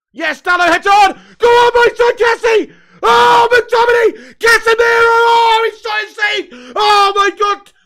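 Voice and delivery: very loud, high frantic screaming. Real, not acted. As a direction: scream every name in the scramble. Screaming at goalmouth scramble.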